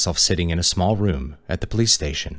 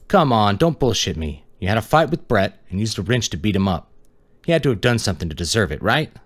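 put-on young detective pushing hard, voice raised and high; pushy detective; 5 semitones higher than usual. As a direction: lean on the kid to confess.

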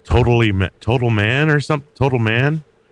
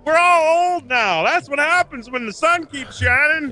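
put-on silly voice. Co-host tells his partner they're all old, put-on high voice. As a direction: stretch it out in a high squeal.

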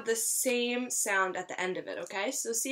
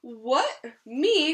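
higher, louder outraged what me; indignant cry; 3 semitones higher, 4 dB louder than usual. Acted. Wolf reacting to being asked into the pot, shocked cry. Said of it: "cried the wolf".

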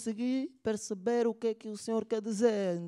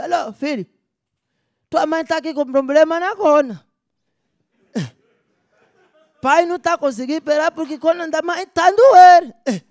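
father shouting down the phone, louder and higher; loud phone call; 7 semitones higher, 11 dB louder than usual. Acted. Father calls his doctor son, yelling loudly about his wife. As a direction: bellow it like a panicked old man on a bad line.

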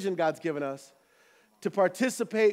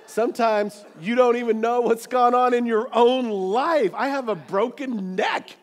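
higher, louder, stretching out the last phrase; emphatic preaching outcry; 4 semitones higher, 5 dB louder than usual. Acted. Preacher revealing his hidden injury to congregation, emphatic outcry. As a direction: build to an incredulous preacher's exclamation. Preacher bursts out after doctor story.

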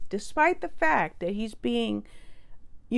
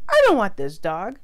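mock loud refusal; 8 dB louder than usual. [name] refuses the rescued dog.

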